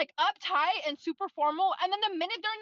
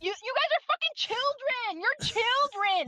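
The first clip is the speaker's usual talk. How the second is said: frustrated outburst; 6 semitones higher, slower than usual. Real, not acted. Ranting at the characters.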